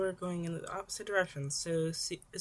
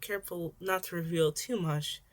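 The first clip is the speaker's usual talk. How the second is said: plain reading voice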